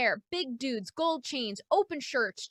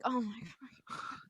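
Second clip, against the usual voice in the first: barely voiced, stunned aside; horrified whisper; 10 dB quieter than usual. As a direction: whisper to yourself in dismay. Muttered aside reacting to the news.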